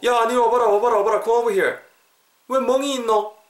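high, urgent dialect call; alarmed calling over. Acted. Teacher acting worried friend, high insistent calling.

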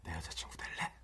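soft mocking whisper; cupped hand whisper. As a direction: mimic an ear whisper, very soft. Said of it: "공기 반소리방 아시죠?".

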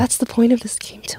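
soft breathy whisper; hushed whisper. Real, not acted. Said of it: "Were you even whispering".

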